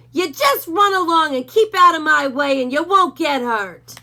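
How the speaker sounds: a bully dog snarling at Hank; menacing Doberman. A narrator's reading. Rufus voice.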